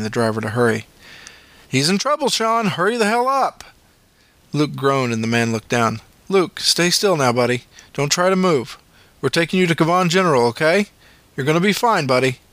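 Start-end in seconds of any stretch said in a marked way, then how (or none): 1.7-3.6 high pressing urging; urgent paramedic. A narrator's reading. Paramedic urging driver Sean to hurry, pressing. 6.3-12.4 higher, reassuring paramedic voice. A narrator's reading. Paramedic calming Luke in the ambulance, reassuring.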